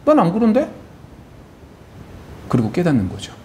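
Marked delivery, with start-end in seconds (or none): 0.1-0.7 higher, grudging reply; an older brother admitting he feels nothing; sullen Cain. Acted. Cain answers Abel, acted quote.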